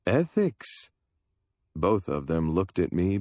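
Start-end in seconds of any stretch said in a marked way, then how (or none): 0.1-0.9 high, puzzled repeat of a strange word; puzzled echo. A narrator's reading. Dealer echoing a word he doesn't value, baffled. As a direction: toss the word back in bafflement.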